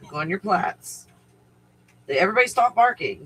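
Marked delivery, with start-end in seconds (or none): 2.1-3.2 quick raised call to stop; fast loud command. Real, not acted. Owner to barking dogs, fast and loud. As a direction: snap them out of barking fast.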